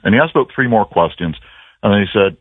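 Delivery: ordinary talk, nothing marked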